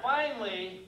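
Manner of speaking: usual speaking voice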